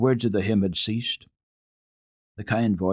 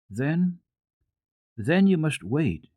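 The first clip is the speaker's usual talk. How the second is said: lightly voiced little girl, higher and slow, sure of herself; a confident child answering, a touch lifted; child assured; 8 semitones higher, slower than usual. A narrator's reading. Heidi comforting the doctor, lightly voiced assured child. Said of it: "she said after a while with assurance".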